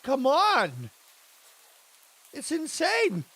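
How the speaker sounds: usual speaking voice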